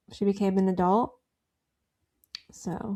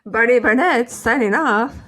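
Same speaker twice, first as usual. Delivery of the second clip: louder newscaster sign-off; reporter voice; 3 semitones higher, 8 dB louder than usual. Acted. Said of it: "It sounds like a reporter".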